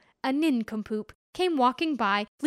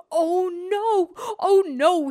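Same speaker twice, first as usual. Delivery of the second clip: high, loud alarmed cry; panicked yelp at the loss; 6 semitones higher, 6 dB louder, slower than usual. Acted. Man seeing the moon fall into the well, alarmed cry. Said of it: "with a cry of alarm".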